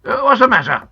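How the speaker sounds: loud, blustering grandfather; shouting. A narrator's reading. Grandpa running downstairs to the noise, shouting. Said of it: "he shouted".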